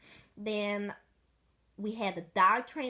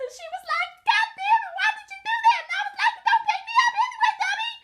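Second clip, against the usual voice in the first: very high and loud, laughing through; laughing high telling; 8 dB louder, faster than usual. Real, not acted. Telling the pee story, high, loud, laughing. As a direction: push it out high, breaking into laughs.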